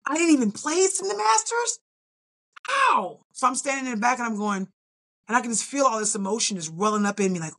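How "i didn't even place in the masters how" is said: high, incredulous questions; stunned that she missed a placing; disbelief. Retold or imitated. On the sideline after missing the masters, high disbelief. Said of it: "standing on the sideline going".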